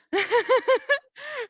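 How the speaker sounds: short burst of laughter; quick high laugh at herself; amused laugh. Real, not acted. Laughs at running over time.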